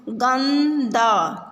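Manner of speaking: गंदा drawn out very slowly, syllable by syllable; slow syllable reading. Shown as a demonstration. Teacher sounds out गंदा for children, very slowly. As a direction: stretch out गंदा for the class to follow. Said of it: "शब्दों को पढ़ेंगे".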